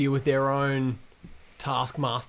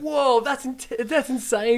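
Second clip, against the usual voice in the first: high mock surprise; 10 semitones higher, much slower than usual. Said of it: "I'm like".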